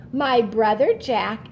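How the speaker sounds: usual speaking voice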